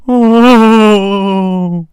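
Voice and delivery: loud wounded-dog howl. A narrator's reading. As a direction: wail like a hurt dog. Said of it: "let out a howl like that of a wounded dog".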